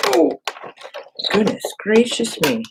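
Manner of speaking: a high, startled outburst at the jam; exasperated surprise. Real, not acted. Machine jams, exasperated.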